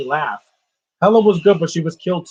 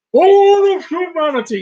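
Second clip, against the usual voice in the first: much higher, louder, slow hammed-up villain line; mock villain voice; 13 semitones higher, 8 dB louder than usual. Mocking the actor's voice for Josh in a much higher, louder, slow villain voice. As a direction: ham up the villain's grand doom. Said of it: "he would talk like this".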